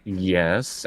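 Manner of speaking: normal talking voice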